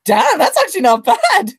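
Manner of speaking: mock-amazed at a good result; surprised. Weighing himself after the trip, pleasantly surprised. Retelling seeing his weight on the scales.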